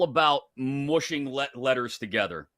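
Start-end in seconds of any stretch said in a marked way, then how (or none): none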